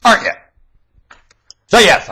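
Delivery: ordinary talk, nothing marked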